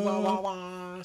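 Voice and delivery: muffled wah wah talk. Acted. Mock sound after title.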